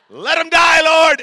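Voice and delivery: high, loud mock prayer shout; hammed-up wailing plea; mock fervent shout. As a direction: cry out to heaven in comic zeal. Wife fired up over insurance payout.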